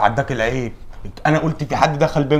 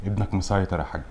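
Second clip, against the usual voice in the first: softer, lower, sheepish excuses; sheepish hushed; 10 semitones lower, 9 dB quieter than usual. Acted. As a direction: mumble your excuses to the father.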